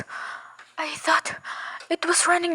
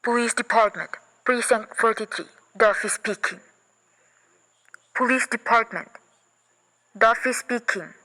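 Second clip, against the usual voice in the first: police sergeant voice; 5 semitones lower than usual. Acted. Desk sergeant answering the phone.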